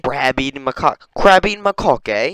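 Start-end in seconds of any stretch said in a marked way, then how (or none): 1.2-2.3 high, loud British lilt; mock British accent. Mocking the monkey's name, mock British accent. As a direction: say it in cheeky British, rising at the end. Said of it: "It's like the only British monkey".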